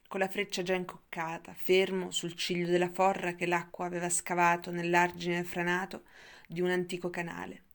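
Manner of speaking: usual speaking voice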